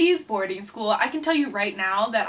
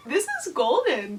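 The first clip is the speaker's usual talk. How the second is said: higher, laughing through the words; 6 semitones higher than usual. Real, not acted. Author delighted by her childhood story, laughing. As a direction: say it while cracking up.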